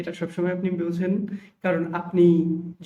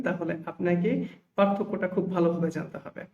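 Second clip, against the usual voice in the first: ordinary talk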